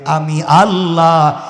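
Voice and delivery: low, slow, drawn-out pronouncement; solemn divine declaration. Retold or imitated. Allah refusing to forgive the insulters, solemn drawn-out declaration. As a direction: stretch the refusal into a long chanted close.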